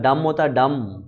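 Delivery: डम said without any b at the end, slowly; teacher stressing डम, no final b; silent b. Shown as a demonstration. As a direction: spell out डम with the b dropped. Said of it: "डम्ब में जो बी होता है वो silent होता है".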